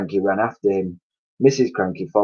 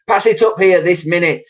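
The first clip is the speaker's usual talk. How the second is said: higher, loud order; demanding hag; 9 semitones higher, 6 dB louder than usual. A narrator's reading. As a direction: demand the cup. Grandma demanding.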